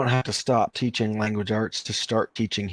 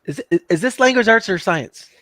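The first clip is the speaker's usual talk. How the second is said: a lightly voiced puzzled kid, a bit higher; student unsure which subject it is, lightly puzzled; 8 semitones higher than usual. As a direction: ask it like a confused student. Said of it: "he goes".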